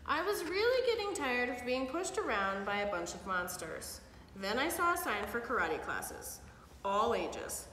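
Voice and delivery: put-on lively read-aloud for kids; storybook reading. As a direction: read it like bedtime story to children. Parent reads picture book aloud.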